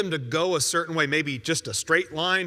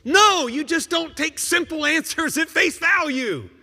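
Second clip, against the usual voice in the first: louder and higher, flatly correcting; forceful rebuttal; 8 semitones higher, 5 dB louder than usual. Acted. Preacher rejecting a listener's excuse, forceful and raised. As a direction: cut through the excuse.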